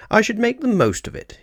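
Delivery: high bright fatherly line; a touch of a cheerful father's voice; lightly voiced Mr Brown. A narrator's reading. Mr Brown advising the children, lightly voiced.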